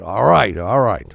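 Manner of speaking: loud mock grudging assent; giving in with a huff; reluctant giving in. Lenny giving in.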